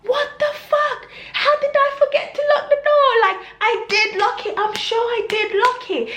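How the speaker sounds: high, frantic self-questioning; high-pitched, disbelieving panic; panicked self-talk. Retold or imitated. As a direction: re-enact panicking at yourself. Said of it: "I was speaking to myself".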